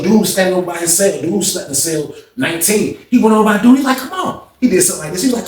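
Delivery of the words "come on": very high, soft squeaky come on; squeaky taunt. Retold or imitated. BB taunting the dude to fight, very high squeaky voice. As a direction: tease him in a tiny high voice.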